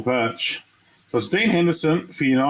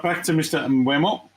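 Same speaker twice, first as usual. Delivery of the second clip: normal talking voice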